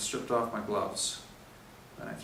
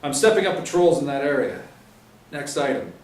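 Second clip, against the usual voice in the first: louder, higher, brisk orders; stern sergeant briefing; 4 semitones higher, 9 dB louder than usual. Acted. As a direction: lay out the patrols firmly.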